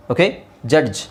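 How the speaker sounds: ordinary talk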